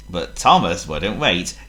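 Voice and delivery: plain reading voice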